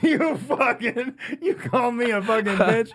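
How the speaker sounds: ordinary talk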